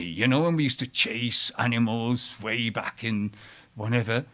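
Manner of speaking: usual speaking voice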